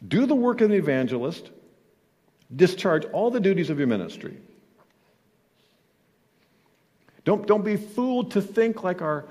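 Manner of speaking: loud, high full-force sermon voice; preaching. Acted. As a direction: preach it out to the congregation.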